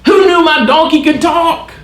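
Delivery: high, hammed-up amazement at a talking donkey; mock astonished Balaam. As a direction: play a man stunned that his donkey talks. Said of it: "Balaam doesn't say".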